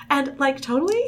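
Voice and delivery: put-on rising Valley Girl voice; Valley Girl uptalk. Shown as a demonstration. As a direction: do the uptalk, rise at the end. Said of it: "speaking like this with all the uptalk".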